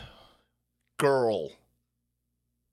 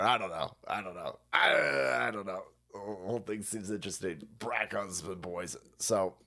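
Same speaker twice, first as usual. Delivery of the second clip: muttering; 11 semitones lower, 7 dB quieter than usual. Real, not acted. Low soft mumbling.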